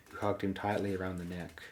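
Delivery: usual speaking voice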